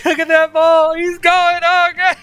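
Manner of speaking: laughing so hard the voice cracks up high; hysterical laughter. Real, not acted. Hysterical laughing at ball combo.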